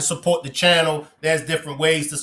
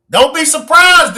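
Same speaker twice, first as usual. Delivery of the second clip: high, loud call-out; loud warning; 12 semitones higher, 8 dB louder than usual. Real, not acted. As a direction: burst out with a teasing warning. Calls out warning to a viewer.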